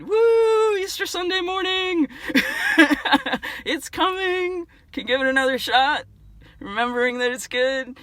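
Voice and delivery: high, squealing playful whoop; excited cheer. Pretend. Cheering with dinosaur Dave for Easter Sunday, high excited squeal.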